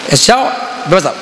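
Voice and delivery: ordinary talk, nothing marked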